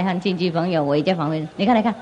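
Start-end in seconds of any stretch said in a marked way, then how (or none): none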